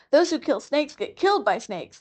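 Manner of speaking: a timid muskrat full of gloom; sorrowful. Acted. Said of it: "more sorrowfully than ever".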